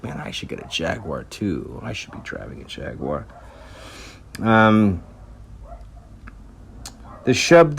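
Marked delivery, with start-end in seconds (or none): 0.0-3.3 envious mutter. Retold or imitated. Jimmy envying Harrison's Jaguar.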